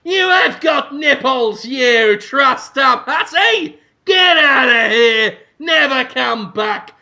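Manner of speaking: high, slow, hammed-up accusing shout; Hugo Weaving. Therapist casting out the exposed patient, outraged shouting. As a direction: denounce with drawn-out theatrical outrage. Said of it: "points at it and says".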